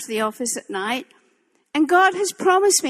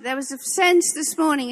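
ordinary delivery

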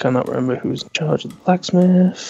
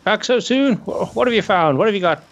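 gnome shopkeeper; 7 semitones higher, faster than usual. Acted.

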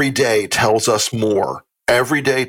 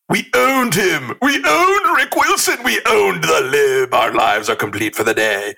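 mock gloating; 5 semitones higher than usual. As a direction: crow it like a smug kid. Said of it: "they think".

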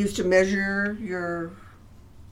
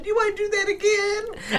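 doting mother; 12 semitones higher, 5 dB louder than usual. Retold or imitated.

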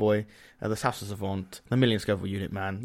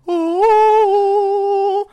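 excited squeal; 11 dB louder than usual. Real, not acted.

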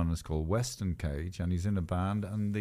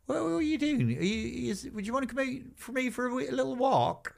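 high-pitched mock Somerset fan; Somerset villager; 13 semitones higher, 4 dB quieter than usual. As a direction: gush in a put-on, high West Country accent from Somerset. Somerset villager invites star out.

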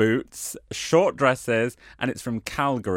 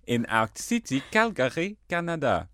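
put-on hammy French accent with throaty French r's; 4 semitones higher than usual. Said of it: "I shall read in my, uh, French accent".

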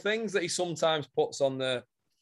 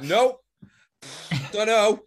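mock clueless reply; 4 semitones higher, 5 dB louder than usual. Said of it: "we'll be like".

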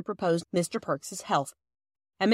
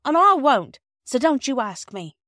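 firm, loud high refusal; 7 semitones higher, 7 dB louder than usual. A narrator's reading. Wife telling husband not to ask her, firm and loud. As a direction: dig in and refuse firmly. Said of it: "said Missus Perks firmly".

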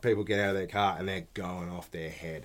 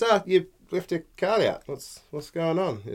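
mildly passive-aggressive, pitched up; 7 semitones higher than usual. Retold or imitated. Agent to shopper who left a cart, mock passive-aggressive. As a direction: imitate a mock-innocent agent calling someone out. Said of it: "these guys are so passive aggressive".

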